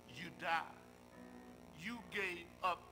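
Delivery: usual speaking voice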